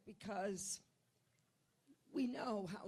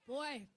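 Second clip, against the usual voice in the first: harsh repeated coughing; coughing fit; 2 semitones higher than usual. Real, not acted. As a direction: cough hard and can't stop. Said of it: "I get allergic".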